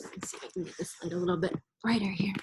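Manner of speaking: quick whisper to herself; whispered aside. Real, not acted. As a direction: whisper it under your breath, fast. Aside while fixing the light.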